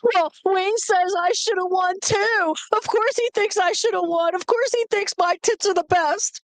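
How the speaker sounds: high-pitched wailing self-justification; mock crying. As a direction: whine indignantly through fake tears. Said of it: "she calls up my mom and she's crying".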